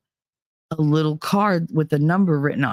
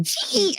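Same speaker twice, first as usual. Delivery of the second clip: forced grin sound; 4 dB quieter than usual. Retold or imitated. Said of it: "this big smile".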